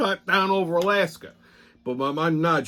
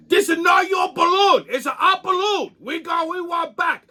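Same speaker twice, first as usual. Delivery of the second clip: mock Chinese accent; 12 semitones higher than usual. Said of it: "their reasoning is".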